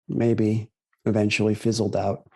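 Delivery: ordinary talk, nothing marked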